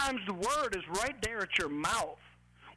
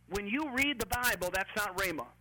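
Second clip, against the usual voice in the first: normal talking voice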